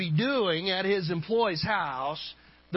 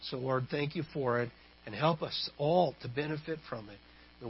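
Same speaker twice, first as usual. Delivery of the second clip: low, measured prayer with small hesitations; public prayer; 5 semitones lower than usual. Real, not acted. Pastor praying with the congregation before the sermon, low and measured.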